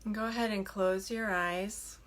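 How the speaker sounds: soft, unhurried meditation voice with long pauses; hushed, settling delivery, leaving room to breathe; guided meditation. Acted. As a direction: gently guide them into stillness. Leads a meditation.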